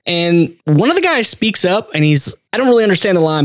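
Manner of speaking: ordinary delivery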